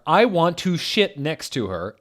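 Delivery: hammed-up declaration; put-on character voice. Same line reread louder.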